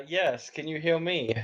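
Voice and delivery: muffled, far from the mic; distant, boxy laptop sound; inside a fishbowl. Real, not acted. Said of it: "it sounds like you're in a fishbowl or you're far away from your microphone".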